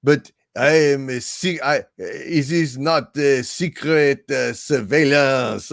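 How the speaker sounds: slow, stilted mock French accent; hammed-up French-accent impression; bad French accent. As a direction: imitate a terribly faked French accent. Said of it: "his French accent was as bad as".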